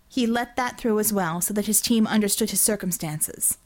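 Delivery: plain reading voice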